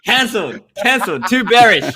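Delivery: high-pitched hammed-up denouncing; comic high-pitched proclamations; mock condemnation. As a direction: call out the verdict in playful outrage. Mock verdict in running joke.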